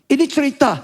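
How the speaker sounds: high, urgent sermon delivery; impassioned preaching. Acted. Preacher tells congregation how God rescues Israel, impassioned preaching. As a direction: press each plea upward.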